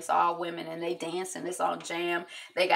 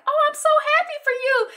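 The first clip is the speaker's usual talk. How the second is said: much higher and louder, a sugary put-on voice; fake cheer; 8 dB louder, faster than usual. Eva fakes delight at the stalker's news, in a high squeal.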